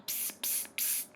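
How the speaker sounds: soft, voiceless psst; hushed psst calls. A narrator's reading. Saucepan man hunts for cats, calling psst. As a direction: hiss softly for a cat.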